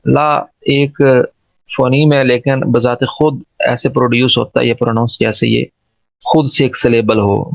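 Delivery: usual speaking voice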